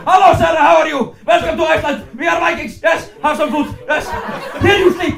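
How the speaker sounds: put-on loud, high shouted Icelandic accent; booming Icelandic host, every phrase a shout. Icelandic family welcomes a visitor, shouting in Icelandic accent. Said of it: "if she visited my family this summer".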